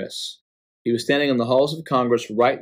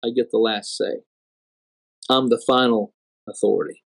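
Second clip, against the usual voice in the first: voice of final authority; 4 semitones lower than usual. Retold or imitated. God at the podium claims the last word, low and measured. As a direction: pronounce it low and final, with a pause between. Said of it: "basically saying".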